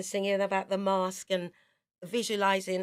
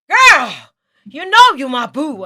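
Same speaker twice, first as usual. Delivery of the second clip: high, loud, belting American greeting; Jocelyn's loud greeting; 7 semitones higher, 19 dB louder than usual. Retold or imitated. Jocelyn greeting Angie, high and loud. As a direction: project it big like an American soul singer. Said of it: "She'd go".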